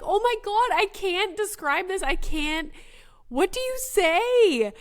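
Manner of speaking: high, loud flustered wail; frantic high-pitched inner freak-out, last question stretched out; mock panic. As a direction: play up a parent totally at a loss. Retells her inner panic as a parent.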